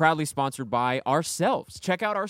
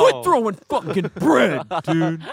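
mock angry snap; 2 semitones higher, 5 dB louder than usual. Singer snapping at the bread-throwing crowd, mock angry. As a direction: bark it like a fed-up singer. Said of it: "the lead singer just snaps".

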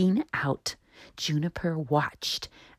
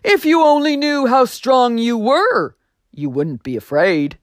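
louder, kindly booming voice; voice on the wind; 7 semitones higher, 7 dB louder than usual. Acted. Voice on the wind encouraging the little tree, loud and kind. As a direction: reassure him like a loving creator.

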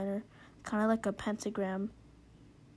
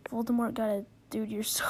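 ordinary delivery

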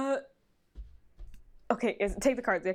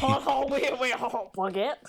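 mock French accent; 3 semitones higher, slower than usual. Shown as a demonstration. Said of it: "How do French people talk again?".

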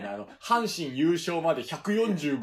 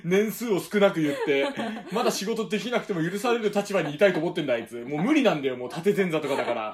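laughing; 6 semitones higher, 8 dB louder than usual. Real, not acted. 後輩を笑いながら責める.

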